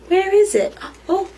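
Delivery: ordinary delivery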